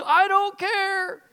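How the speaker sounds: put-on high, slow tearful child; scared refusal. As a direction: refuse through fear, almost crying. Scared boy, voiced.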